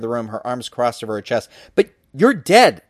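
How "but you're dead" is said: high, loud and slow disbelief; a kid stunned to see a dead girl; blurted. A narrator's reading. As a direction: blurt it out in shock. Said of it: "I blurted out".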